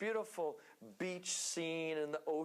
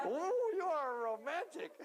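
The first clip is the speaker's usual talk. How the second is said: teasing; 9 semitones higher, 4 dB louder than usual. Real, not acted. Teasing a husband in the crowd.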